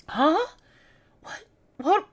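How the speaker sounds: normal talking voice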